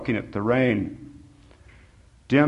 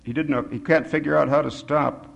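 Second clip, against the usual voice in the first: usual speaking voice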